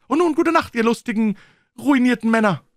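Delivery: high, loud farewell toast; calling out. Acted. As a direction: cry out a reckless good night. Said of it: "rief er". (hoch und laut gerufen)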